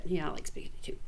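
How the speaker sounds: plain reading voice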